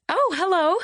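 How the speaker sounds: startled and delighted to see someone; exuberant surprise. Acted. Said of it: "with great exuberance and surprise".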